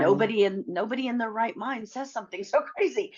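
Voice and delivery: high, disbelieving, laughing through it; can you believe this, laughing; exasperated laugh. Real, not acted. Telling the interviewer how crazy his words were, in laughing disbelief.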